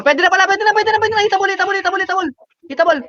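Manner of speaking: high, urgent chant of hitabol; excited shouting. Real, not acted. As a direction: yell it up high, chasing the kill. Chasing down fleeing enemies.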